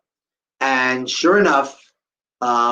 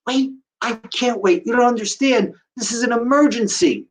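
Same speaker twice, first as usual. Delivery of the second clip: urgent protest; 7 semitones higher than usual. Retold or imitated. Pleading urgently when told to wait.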